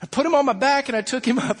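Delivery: ordinary delivery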